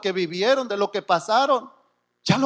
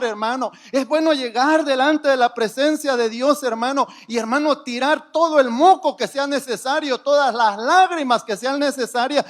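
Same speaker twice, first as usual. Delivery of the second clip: ordinary talk, nothing marked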